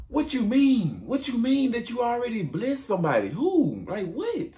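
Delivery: high, outraged disbelief; shrill pitch of a cheated son; Esau outraged. Retold or imitated. Esau cheated of his blessing, demanding in high outraged disbelief. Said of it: "He like".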